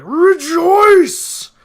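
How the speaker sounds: huge high-pitched triumphant bellow; booming battle cry, held long; Susano. Retold or imitated. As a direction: roar it like an exultant boss. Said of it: "as Susano is like".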